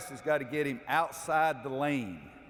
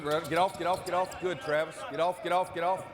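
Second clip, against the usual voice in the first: coach calling out; 4 semitones higher than usual. Real, not acted. Coach calling out during drill.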